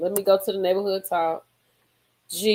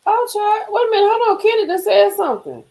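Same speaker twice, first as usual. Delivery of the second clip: high, loud stunned reaction; shocked gasp; 13 semitones higher, 5 dB louder than usual. Real, not acted. Vlogger stunned by Candy's divorce news, high and loud. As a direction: hold everything, the tea just dropped.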